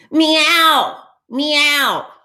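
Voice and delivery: high, loud meowing; acted duck calling out in meows. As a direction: meow up to the sky. Duck meows loudly at passing ducks.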